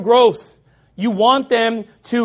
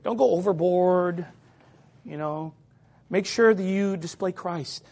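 much softer and lower, meek murmur; mock gentle advice; 5 semitones lower, 6 dB quieter than usual. Preacher mocking how God did not tell prophets to be nice, meek. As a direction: sneer at the timid advice softly. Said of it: "he didn't say".

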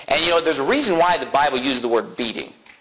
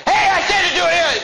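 high, loud boss's yell; yelling at employees; 5 dB louder than usual. Acted. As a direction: bellow an order at your staff. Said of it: "That's the yelling at employees".

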